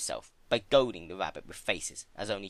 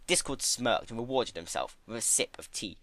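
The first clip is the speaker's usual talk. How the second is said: ordinary delivery, nothing marked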